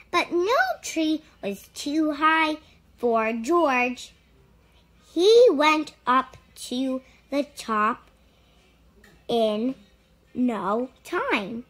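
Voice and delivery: ordinary delivery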